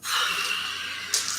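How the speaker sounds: soft, breathy exhale; long sigh. Real, not acted. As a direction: let out a long, voiceless sigh. Long breath out between turns.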